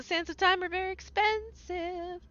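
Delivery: words stretched by a yawn; yawning while talking. Real, not acted. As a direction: talk through a yawn. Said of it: "Sorry that I just yawned while I was talking".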